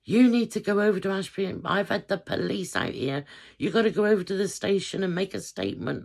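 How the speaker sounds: dad banging on the bedroom door, angry and urgent; imitating angry dad. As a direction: order her out of bed, alarmed. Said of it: "he's banging on the door".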